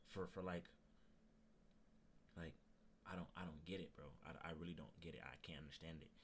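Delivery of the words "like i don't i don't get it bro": ordinary talk, nothing marked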